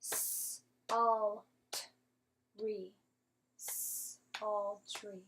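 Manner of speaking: S-al-t-ry broken into clapped bits; teacher sounding out a hard word. Shown as a demonstration. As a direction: sound out S-al-try slowly in pieces. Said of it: "Let's clap it out".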